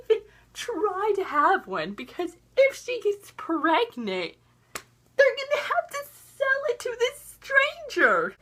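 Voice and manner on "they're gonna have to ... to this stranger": high-pitched, exasperated outrage; appalled. Real, not acted. Viewer appalled by the baby deal, exasperated. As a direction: let the pitch soar in disbelief.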